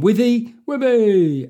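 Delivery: loud, high, drawn-out cheer; cheering call. Acted. Host hailing a reviewer by name, loud cheering call.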